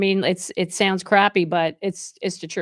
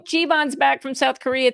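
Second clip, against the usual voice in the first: usual speaking voice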